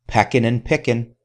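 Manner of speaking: ordinary delivery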